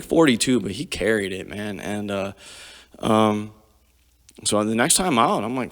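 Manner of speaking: normal talking voice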